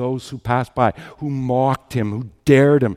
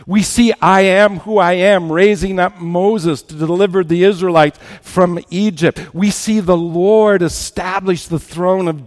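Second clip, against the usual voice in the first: high, loud, building sermon cadence; impassioned preaching; 5 semitones higher, 5 dB louder than usual. Acted. Preacher recalling God's promises through history, impassioned preaching. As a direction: drive each phrase home to the congregation.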